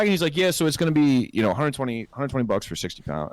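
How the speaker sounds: ordinary delivery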